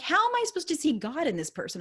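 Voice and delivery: high-pitched mock exasperation; worked up. Customer retelling her thoughts about the clerk, worked up. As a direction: retell it as a worked-up, incredulous protest. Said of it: "getting super worked up".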